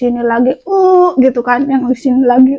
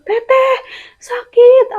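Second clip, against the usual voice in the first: high, strained cry to her sister; calling out in pain; 10 semitones higher, slower than usual. Retold or imitated. Calling her sister from the bathroom in pain, high strained cry. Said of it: "keluar teriak gitu".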